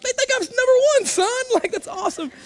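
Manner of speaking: squeaky pretend pride; mock delighted falsetto. Sarcastic joy at rude gesture.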